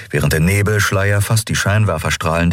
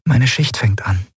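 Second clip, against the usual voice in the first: ordinary delivery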